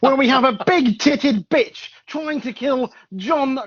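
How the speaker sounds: loud, high, stretched-out hype announcement; mock-epic show opener at full volume; over-energetic intro. Shown as a demonstration. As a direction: belt it out with hammed-up host energy. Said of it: "the energy is lacking so much".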